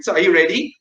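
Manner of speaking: ordinary talk, nothing marked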